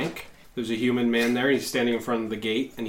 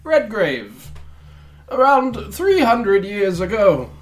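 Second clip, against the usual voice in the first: higher, slow, ominous recital; solemn storytelling banker; 9 semitones higher, 3 dB louder, slower than usual. Acted. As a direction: relay the legend gravely. Banker tells estate history.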